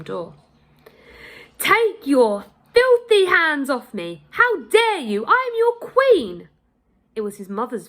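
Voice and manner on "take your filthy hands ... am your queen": high, loud, outraged royal command; furious queen's shout. A narrator's reading. Queen shouting at the guards dragging her, outraged. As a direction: shout it at the guards with wounded dignity.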